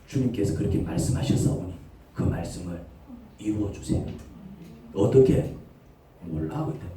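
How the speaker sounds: low, whispered prayer; hushed prayer. Acted. Believer praying low and whispered to God. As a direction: pray it quietly.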